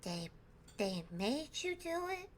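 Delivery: put-on a child gently working it out; slow, earnest small boy; Rad the kid. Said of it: "and he goes".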